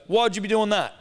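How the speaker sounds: high-pitched indignant question; retold challenge. Dad confronting young golfer, retold indignation. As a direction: imitate an affronted dad questioning someone.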